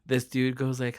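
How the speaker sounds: ordinary delivery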